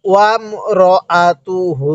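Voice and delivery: ওয়ামরাআতুহু syllable by syllable, last hu held; measured chant of ওয়ামরাআতুহু with drawn-out ending; slow model recitation. Shown as a demonstration. Teacher asking learners to say along, slow measured ওয়ামরাআতুহু. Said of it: "হ্যাঁ, পড়ুন".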